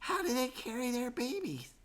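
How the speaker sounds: put-on high, soft childlike voice; small joey pointing out birds; little Freddy voice. A narrator's reading. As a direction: chirp up eagerly. Baby kangaroo speaking.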